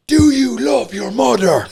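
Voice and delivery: high, loud, slow challenge; aggressive sales pitch. Acted. Salesman challenging the gang leader, slow loud high. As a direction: hit him with it like a showdown. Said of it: "aggressive pitch".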